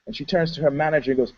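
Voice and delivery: normal talking voice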